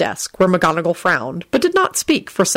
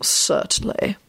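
bored; 3 semitones higher, 4 dB quieter, slower than usual. A narrator's reading. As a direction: concede with weary indifference. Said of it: "responded in a bored voice".

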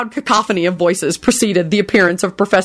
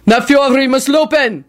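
commanding bellow. Acted. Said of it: "he bellowed commandingly".

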